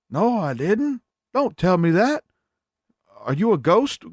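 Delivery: hammed-up squeaky grandpa; grumpy grandpa. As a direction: play a cranky grandpa demanding food. Grandpa denies, cranky.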